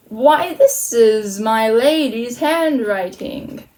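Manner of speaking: drawn-out, slow character reading; slow murmur. Acted. Malvolio finds a letter on the path, murmuring slowly. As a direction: take it slowly, stretching the words. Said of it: "Malvolio murmured slowly".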